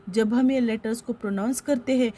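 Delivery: ordinary delivery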